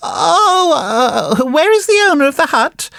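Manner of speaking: high-pitched, wailing puppet voice; very surprised. A narrator's reading. Puppet boy finds nobody in the hut, very surprised. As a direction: exclaim in high puppet astonishment. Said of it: "very much surprised".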